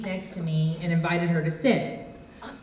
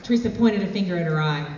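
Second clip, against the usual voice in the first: usual speaking voice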